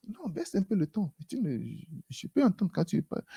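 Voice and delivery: quiet, patient voice calming a shouter. Acted. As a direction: ask them gently to bring it down. The Lord answering the shouter.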